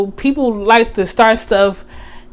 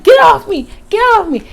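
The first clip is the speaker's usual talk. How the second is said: retold yell; 10 semitones higher, faster than usual. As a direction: shout it while being carried off. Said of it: "I'm like".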